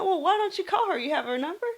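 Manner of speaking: high, bright retold suggestion, pitched well up; urging him. Nurse nudging a lonely patient to call, high. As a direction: coax him lightly toward the phone.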